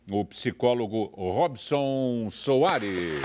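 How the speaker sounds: slow, stretched name introduction; announcer voice. Pretend. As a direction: draw the guest's name out. Introducing guest, drawn out.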